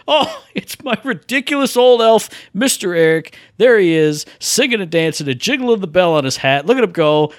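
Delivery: usual speaking voice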